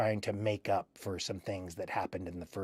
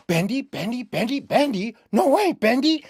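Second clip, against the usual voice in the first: high, loud excited fan squeals, imitating; 12 semitones higher, 8 dB louder than usual. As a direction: mimic thrilled fans shouting the name. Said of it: "people would go".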